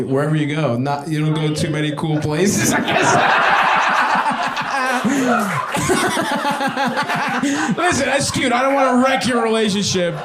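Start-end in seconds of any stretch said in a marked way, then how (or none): 2.7-3.1 laughing through the words. Real, not acted. Comic teasing crowd member, laughing. 3.2-7.7 a long real laugh at the crowd. Real, not acted. Comic cracking up at audience member's answer. 7.8-10.1 laughing while teasing. Real, not acted. Comic teasing a man about his girlfriend, laughing.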